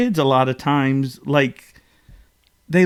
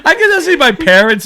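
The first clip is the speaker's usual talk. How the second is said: ordinary delivery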